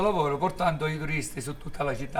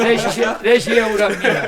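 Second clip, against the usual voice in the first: laughing; 5 semitones higher, 8 dB louder than usual. Retold or imitated. Punchline of funny retold story.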